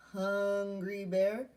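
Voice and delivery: ordinary talk, nothing marked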